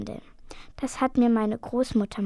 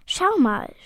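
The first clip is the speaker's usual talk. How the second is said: higher, louder exclamation of wonder; amazed; 5 semitones higher, 5 dB louder than usual. A narrator's reading. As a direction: point it out in astonishment. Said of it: "staunt Charlotte". (staunend ausgerufen)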